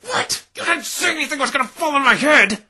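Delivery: high, loud indignant outburst. Acted. Hit on the head, scolding her friend indignantly. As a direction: protest the unfairness. Angry at friend after injury.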